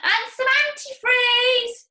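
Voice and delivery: slow, high, loud call after him; shout. Acted. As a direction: shout after the running father. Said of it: "George shouted after him".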